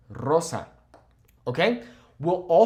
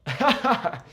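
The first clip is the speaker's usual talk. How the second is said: giggle; 9 semitones higher than usual. Real, not acted. Giggling between tongue twister takes.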